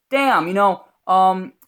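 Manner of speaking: rough attempt at a New York accent. Actor practising for a play, clumsy New York accent. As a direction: try on a badly done New York accent. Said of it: "this is my New York accent".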